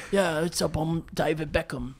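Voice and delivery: a quiet imagined tough Beckham. Retold or imitated. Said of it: "I thought he was gonna sound like".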